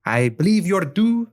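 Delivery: put-on light Spanish accent on the lieutenant; lightly voiced Spanish lieutenant. Lieutenant calling up to the pirates from the dock, in a light Spanish accent. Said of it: "young Spanish woman".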